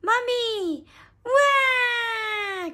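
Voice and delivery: put-on high, loud toddler wail; mock crying. A narrator's reading. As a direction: wail for mummy, hammed up. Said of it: "cried Richard".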